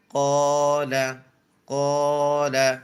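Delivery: slow, stretched blend of the syllables; teacher stretching the joined word slowly; drawn-out demo. Shown as a demonstration. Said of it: "কফ খালি আলিফ জবর ক".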